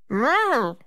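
loud high Never; Nutcracker cry. A narrator's reading. Nutcracker drawing his cutlass, declaring loudly. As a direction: proclaim it boldly. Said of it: "agreed the Nutcracker".

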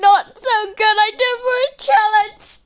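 high, loud whine of displeasure; loud complaint. Real, not acted. Complaining after eating the penalty.

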